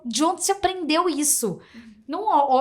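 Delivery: normal talking voice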